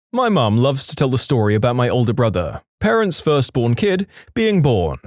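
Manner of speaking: ordinary delivery, nothing marked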